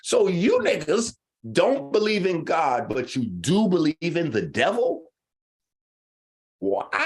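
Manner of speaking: high, disbelieving challenge; incredulous retort. Retold or imitated. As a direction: throw the question back in disbelief. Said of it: "I'm like".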